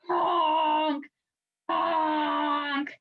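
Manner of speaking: bad horn honk. A narrator's reading. Melba's first blow on the horn, a sour honk. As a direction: honk like a sour trombone blast. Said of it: "It sounded bad, like a howling dog".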